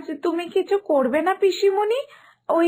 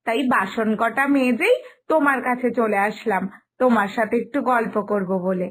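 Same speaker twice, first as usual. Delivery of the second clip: ordinary talk, nothing marked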